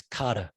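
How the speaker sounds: "Cotter" with no R, ending "ah"; New York pronunciation. Shown as a demonstration. Telling how the surname was always said, "Cotter" as "Cotta". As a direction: drop the final R of "Cotter", New York style. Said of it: "Everything becomes an A or a vowel".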